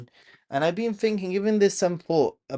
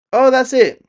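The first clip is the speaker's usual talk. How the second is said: much higher and louder burst; excited realisation; 10 semitones higher, 12 dB louder than usual. Real, not acted. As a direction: blurt it out as it comes back. Suddenly remembering the channel name.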